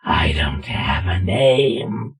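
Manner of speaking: low, slow whispery growl; creepy growl. Acted. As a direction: growl it slowly like an unseen creature. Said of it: "what the growl was".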